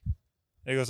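ordinary talk, nothing marked

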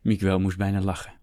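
ordinary delivery, nothing marked

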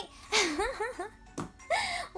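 bursting into high laughter. Real, not acted. Laughing at own rambling.

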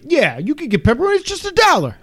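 high-pitched mock New Yorker; New York accent. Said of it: "but he is from New York".

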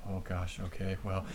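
low, soft, deflated mutter; understated resignation. Retold or imitated. Friend reacting to being pulled over, quietly resigned. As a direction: take it calmly, almost under your breath. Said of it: "Zach's just like".

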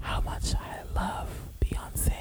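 breathy ASMR whisper, unvoiced. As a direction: whisper close to the mic like an ASMR video. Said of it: "Why are you whispering?".

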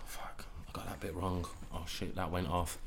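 breathy whisper to himself; soft whisper of private panic; whispered self-talk. Retold or imitated. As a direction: hiss it under your breath as an inner thought. Retelling his own thoughts mid-battle.

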